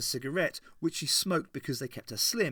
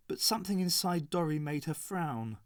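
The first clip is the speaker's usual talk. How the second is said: plain reading voice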